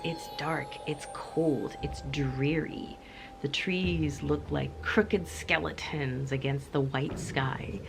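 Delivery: ordinary talk, nothing marked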